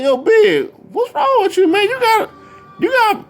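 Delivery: very high-pitched mock exasperation; retold exasperated challenge. Fan confronting Big about his breathing, exasperated. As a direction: challenge him, voice way up.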